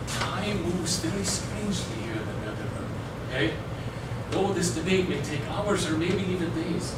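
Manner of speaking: soft, low confiding voice; hushed explaining. Acted. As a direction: quietly let the newcomer in on a mystery. Ghost confides rule of the netherworld.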